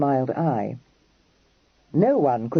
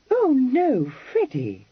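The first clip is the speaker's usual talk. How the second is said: shocked cry; 11 semitones higher, slower than usual. A narrator's reading. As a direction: cry out, taken aback. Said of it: "she cried, shocked".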